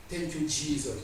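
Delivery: usual speaking voice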